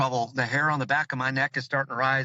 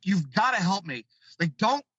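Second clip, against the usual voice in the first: ordinary talk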